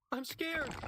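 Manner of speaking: put-on a girl pulling away in fear; scared girl. A narrator's reading. Frightened girl cries out.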